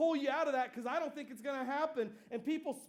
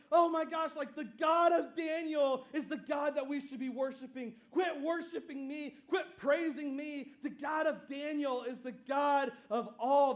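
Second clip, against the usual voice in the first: raised, excited outburst; freaking out; 3 semitones higher, 4 dB louder than usual. Retold or imitated. King reacts to Daniel being alive, freaking out. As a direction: exclaim it like an awestruck king. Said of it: "the king freaks out".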